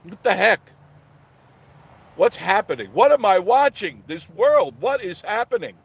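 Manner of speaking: loud, high-pitched bewilderment; incredulous outburst; exasperated disbelief. Real, not acted. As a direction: cry out at a world gone mad. Exclaims in disbelief at world.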